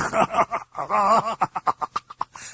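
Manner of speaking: high-pitched demon cackle; demonic laugh. A narrator's reading. Demon delighted with the priest's slyness, high cackle. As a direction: cackle in a creature's voice.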